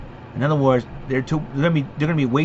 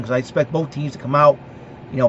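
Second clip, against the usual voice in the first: usual speaking voice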